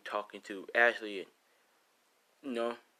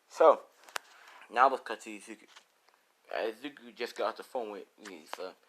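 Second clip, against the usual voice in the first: ordinary delivery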